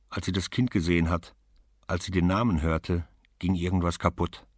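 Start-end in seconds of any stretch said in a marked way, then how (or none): none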